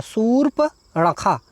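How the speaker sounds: शूर्पणखा said very slowly, every syllable clear; careful pronunciation. Shown as a demonstration. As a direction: drawl शूर्पणखा out slowly for the students. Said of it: "उसका उच्चारण क्या होता है".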